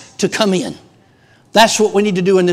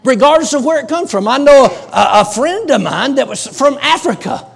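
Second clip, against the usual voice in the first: loud, high-pitched revival exhortation; full-force preaching; 6 semitones higher, 6 dB louder than usual. Real, not acted. Preacher urging congregation to pray for the nation, forceful preaching. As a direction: drive each plea out like a revival preacher.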